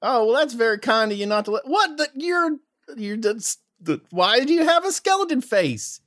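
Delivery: high folksy guard voice; Southern guard. Acted. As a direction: question strangers like a suspicious Southern sentry. Guard questions.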